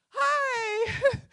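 high-pitched, loud, flustered hello; nervous eager greeting. Retold or imitated. Lawyer greeting an angry room, high and loud. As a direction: squeak out a frantic hi to a hostile crowd.

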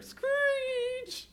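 very high squealing screech; tire screech. Acted. Narrator voicing the van's tires, high screech. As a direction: shriek it like brakes.

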